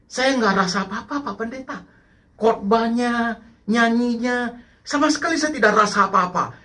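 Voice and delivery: complaining churchgoer, pitched well up; lightly voiced churchgoer. Churchgoer telling the pastor they feel nothing, high-pitched complaint.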